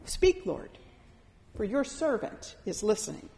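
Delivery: a soft, humble offering; hushed prayer. Shown as a demonstration. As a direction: pray it quietly, inward. Prayer repeated as a quiet stance.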